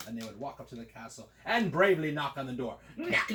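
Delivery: plain reading voice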